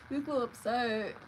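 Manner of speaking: condescending sibling-style taunt; needling. Retold or imitated. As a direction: draw it out with fake sympathy. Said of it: "know how to get under someone's skin".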